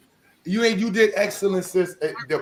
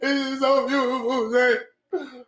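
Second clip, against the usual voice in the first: higher, half-joking overcome voice; a touch of mock weeping joy; mock inner crying; 7 semitones higher, slower than usual. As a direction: play the teary inner voice, lightly.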